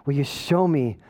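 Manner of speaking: low, soft self-searching plea; low, subdued appeal to God; humble prayer. Acted. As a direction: ask God quietly, with pauses. Humble prayer after mock rant.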